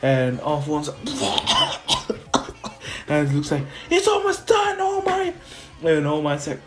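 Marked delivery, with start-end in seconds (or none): 1.0-3.0 long choking cough; imitated winded villain; impact splutter. Imitates villain slammed into ground. 3.9-5.4 very high, strained mock shout; acted-out young hero yell; urgent battle call. Izuku calls to partner mid-fight.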